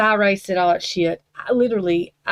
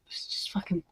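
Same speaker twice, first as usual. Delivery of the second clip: exasperated curse in a whisper; fed-up voiceless swear; 10 dB quieter than usual. Real, not acted. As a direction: breathe the curse out in frustration.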